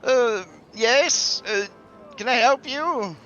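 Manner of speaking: high-pitched, anxious bank teller; loud, high fretful clerk voice; worried bank teller. Acted. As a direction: squeak out a nervous offer of help. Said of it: "this worried bank teller says".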